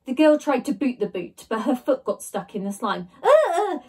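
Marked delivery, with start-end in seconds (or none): 3.2-3.8 high loud scream. A narrator's reading. Jemima screaming as her foot sticks, loud. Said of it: "she screamed".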